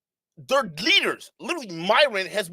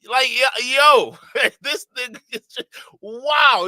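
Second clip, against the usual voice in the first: louder, higher and slow, stunned; loud amazed outburst; 6 semitones higher, 6 dB louder, slower than usual. Real, not acted. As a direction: react in disbelief. Amazed reaction, laughing.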